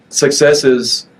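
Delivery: ordinary talk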